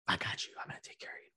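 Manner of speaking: mocking whisper of an empty promise. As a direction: breathe it out as a sneering imitation.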